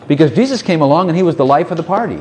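normal talking voice

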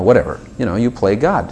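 ordinary talk, nothing marked

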